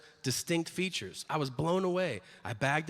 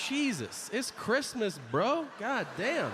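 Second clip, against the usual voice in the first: exasperated outburst; 8 semitones higher, slower than usual. Real, not acted.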